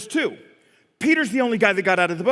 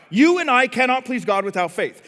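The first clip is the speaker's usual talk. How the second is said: usual speaking voice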